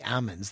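almonds said "AH-munds", silent L; almonds without L. Shown as a demonstration. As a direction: leave the L out of almonds. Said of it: "They don't pronounce the L".

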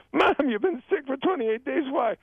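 high-pitched, incredulous questioning; alarmed son. Acted. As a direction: play a son aghast at his mother. Acting alarmed son in joke.

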